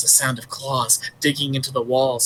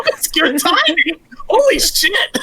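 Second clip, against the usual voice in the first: much higher, loud laughing exclamation; 5 dB louder, slower than usual. Real, not acted. Player laughing at the group's timing, loud and high. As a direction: crack up mid-sentence.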